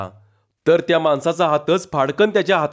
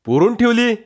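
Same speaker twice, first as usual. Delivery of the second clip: ठेवली jumps up high and louder in disbelief; incredulous repeat, higher and louder; surprised echo; 7 semitones higher, 4 dB louder than usual. A narrator's reading. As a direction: echo पुरून ठेवली? amazed, pitch leaping on ठेवली. Policeman echoes in disbelief.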